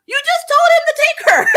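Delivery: high, loud laughing outburst; laughing disbelief. Retold or imitated. Reviewer yelling at a character's silly question, laughing shout. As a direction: shriek it through laughter in disbelief. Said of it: "And I'm like".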